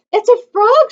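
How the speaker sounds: high, loud, thrilled cry; delighted shout. A narrator's reading. Martha delighted at a frog, shouting high. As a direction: call it out with delight. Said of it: "she shouted".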